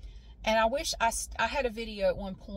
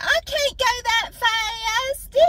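put-on very high and slow, a whiny frightened child; scared kid; 9 dB louder, much slower than usual. Twelve-year-old answering her dad on the go-kart, whining in fear. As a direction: drag it out like a little girl too scared to speed up. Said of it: "I said".

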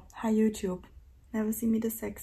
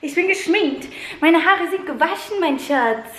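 higher and louder, gushing; excited; 6 semitones higher, 9 dB louder than usual. Real, not acted. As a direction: gush with excitement. Excited about her looks.

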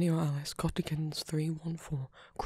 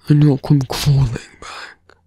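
villain voice; 16 dB louder than usual. Acted. Villain taunting the little hero before leaving, close menacing whisper. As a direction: toy with the hero like a smug villain, murmured close to the ear.

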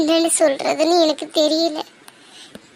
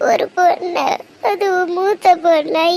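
ordinary talk

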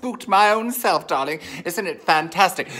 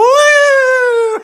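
high, loud whooping shriek of a laugh; 11 dB louder than usual. Real, not acted. Host shrieking with laughter over the liqueur, loud high laugh. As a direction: let out a shrieking cackle of joy.

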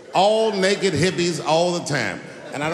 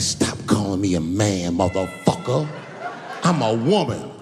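much lower, gruff-voiced woman; deep tough woman; 8 semitones lower than usual. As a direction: drop way down and growl it. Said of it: "She said".

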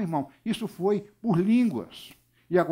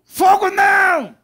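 high, drawn-out shriek; very loud, high-pitched demonic cry; demon-possessed woman's scream; 13 semitones higher, 11 dB louder, slower than usual. Retold or imitated. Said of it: "a mulher endiabrada gritou".

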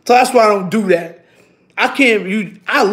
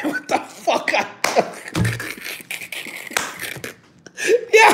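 wheezing laugh; 13 semitones higher, 4 dB quieter than usual. Real, not acted. Laughing at own story.

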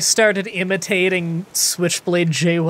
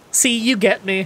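usual speaking voice

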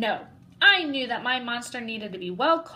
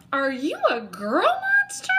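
louder, higher shocked question; surprised; 7 semitones higher, 6 dB louder than usual. Acted. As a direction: blurt it out in surprise. Boy shocked by the bow.

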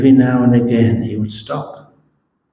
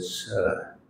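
slow, soft and deliberate; a grave monk's slow, quiet verdict; very slowly; 5 dB quieter, slower than usual. Retold or imitated. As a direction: let each word land calmly, with a pause. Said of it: "very slowly ... said".

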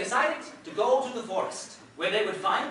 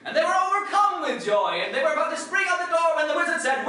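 excited; 9 semitones higher, 5 dB louder than usual. Real, not acted. Excited laughing narration.

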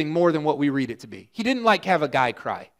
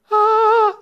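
triumphant ta-da shout; 6 dB louder than usual. Pretend. Pretend miracle reveal.

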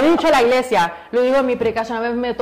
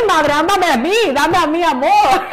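laughing plea; 8 semitones higher, 6 dB louder than usual. Retold or imitated. Quoting her plea, laughing.

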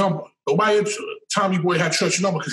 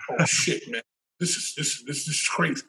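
whispered and much softer, with a nervous laugh; stunned whisper; 4 semitones lower, 6 dB quieter than usual. Retold or imitated. Walking into the office where everyone stares, whispered in shock. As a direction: breathe it out like you can't believe what you're seeing.

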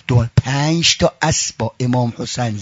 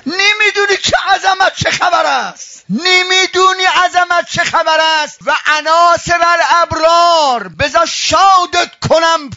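preaching at full force. Acted. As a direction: chant his praise with full lungs. Eulogy of Hossein at full force.

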